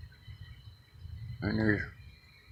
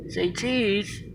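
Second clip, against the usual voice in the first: soft falsetto coaxing; high soft coax; 11 semitones higher, 7 dB quieter than usual. Real, not acted. Filming birds, coaxing them to pose in a soft high voice. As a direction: coo it up high and quiet for the camera.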